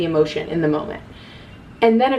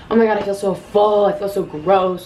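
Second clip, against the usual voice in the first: mock an overfull friend's complaint, a little exaggerated; acted complaining friend. Best friend after a shared meal, mildly exaggerated complaining.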